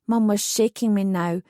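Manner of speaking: ordinary delivery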